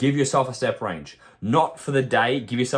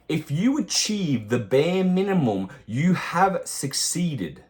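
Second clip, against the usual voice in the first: slow, higher, weighing each word; slow emphatic point; 5 semitones higher, slower than usual. Real, not acted. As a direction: spell it out slowly so it sinks in. Coach stressing key point.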